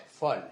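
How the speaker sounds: "folle" with an open O, low-pitched; open-mid O in "folle". Shown as a demonstration. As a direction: demonstrate the open vowel in "folle". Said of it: "Ça, c'est un O ouvert".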